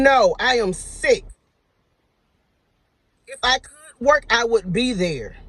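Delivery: slow, polite but firm reply. Retold or imitated. To the manager texting her, a slow polite but firm refusal. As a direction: lay it out slowly, politely, no give. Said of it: "I politely text them".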